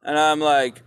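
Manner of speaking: ordinary talk, nothing marked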